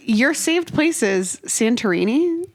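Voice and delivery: ordinary talk, nothing marked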